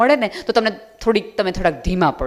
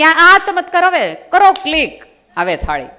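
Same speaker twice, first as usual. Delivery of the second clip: mock grand miracle; 7 semitones higher, 6 dB louder than usual. Mock miracle of food apps.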